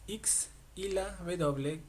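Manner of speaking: ordinary delivery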